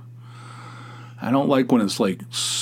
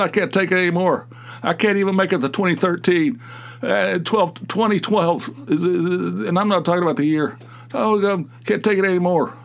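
exasperated quote; 7 semitones higher than usual. Retold or imitated. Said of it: "I said".

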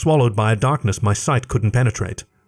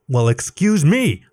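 slow, sarcastic, higher; scoffing; 4 semitones higher, slower than usual. A narrator's reading. Alec raising his paws at Leif: sarcastic scoff. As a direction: scoff with mock offense. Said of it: "I scoffed".

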